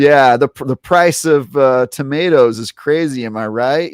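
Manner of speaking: playful, chatty fake conversation; mock small talk. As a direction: make idle chatter with strangers, hammed up. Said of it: "Pretend to converse".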